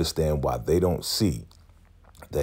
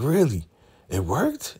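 higher mock surprise; surprised; 9 semitones higher than usual. Zane surprised it worked, higher. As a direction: ask in pleased disbelief. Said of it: "He's like".

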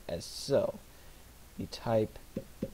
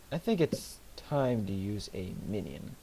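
ordinary talk

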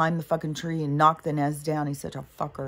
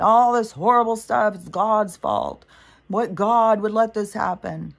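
1 mock complainers blaming God; 4 semitones higher, 6 dB louder than usual. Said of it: "people wanna blame stuff on God".

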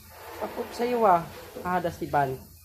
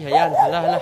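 loud, rising excited call; raised voice, mounting excitement; excited urging; 12 dB louder than usual. Real, not acted. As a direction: egg the dog on loudly. Urging the dog as it runs.

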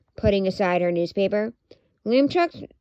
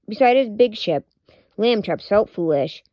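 usual speaking voice

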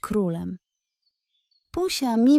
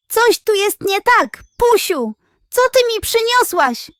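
much higher, loud alarmed wail; panicked groaning cry; 12 semitones higher, 9 dB louder than usual. A narrator's reading. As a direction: cry out as the stomach turns. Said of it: "jęknął".

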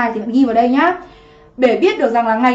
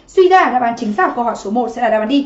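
ordinary delivery

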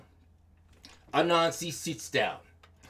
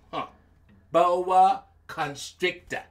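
plain reading voice

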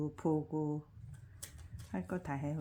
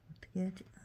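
ordinary talk, nothing marked

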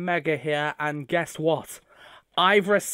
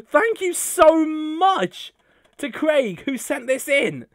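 overwhelmed thanks; 9 semitones higher, 3 dB louder, slower than usual. Real, not acted.

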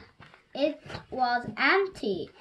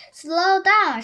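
shouting; 7 semitones higher, 9 dB louder than usual. A narrator's reading. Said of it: "shouted Harry Smith".

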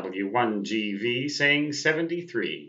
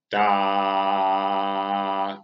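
spoken morse code; 2 semitones lower than usual. Retold or imitated. Imitating steady carrier tone.

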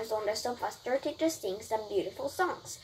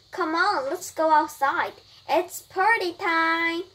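higher, louder cheer; rally everyone, stretching the last words; excited rallying; 6 semitones higher, 5 dB louder than usual. Acted. Mom calls Brian to the party, excited.

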